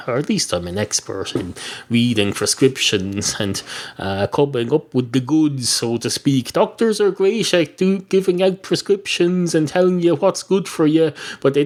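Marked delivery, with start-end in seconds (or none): none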